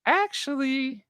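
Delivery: high, put-on girly voice; mock Leah. Imitating Leah confessing her crush on Quinn, high mock voice. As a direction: ham up Leah's confession. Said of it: "Leah goes".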